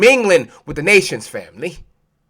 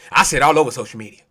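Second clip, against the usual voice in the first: quick aside; 8 semitones lower, 4 dB quieter, much faster than usual. Shown as a demonstration. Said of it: "repeated with emphasis".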